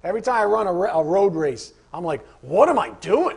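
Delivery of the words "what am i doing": louder, exasperated outcry; mock exasperation, louder than usual; exasperated runner. Struggling runner questioning himself mid-race, exasperated.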